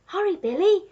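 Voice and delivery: plain reading voice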